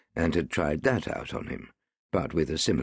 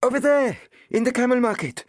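put-on high, breathless boy's voice, pitched way up; panting small boy; 6 dB louder than usual. A narrator's reading. Small boy panting to the policeman Owen, high and breathless. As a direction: gasp it out like an eager urchin. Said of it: "panted the small boy".

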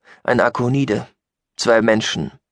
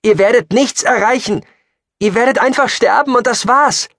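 very high, loud outburst; desperate shouting; 7 dB louder than usual. Acted. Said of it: "rief er verzweifelt". (verzweifelt und laut geschrien)

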